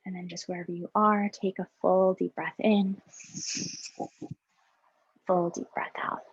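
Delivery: soft, calm breathing cues with long pauses; guided meditation. Acted. Facilitator guiding teachers through breaths, soft meditation voice. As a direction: lead the breath in and out gently.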